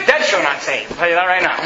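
usual speaking voice

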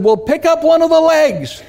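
high-pitched acted call; hollering back. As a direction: play the uncle calling instructions down. Uncle hollers back instructions.

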